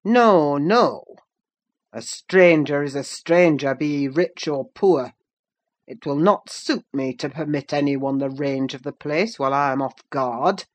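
stern, forceful refusal; unmannerly master. A narrator's reading. Master refusing a stranger a bed in his house, stern. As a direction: refuse firmly, starting loud. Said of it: "said the unmannerly wretch".

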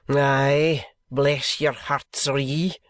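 slow, broad Scottish drawl; testy doctor with a Scottish accent; broad Scotch. A narrator's reading. Scottish doctor arriving at the bedside, broad Scotch accent. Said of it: "a broad Scotch tongue".

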